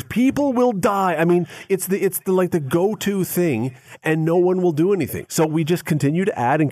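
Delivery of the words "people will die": mock panic. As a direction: parody the protester once more. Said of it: "somebody screams".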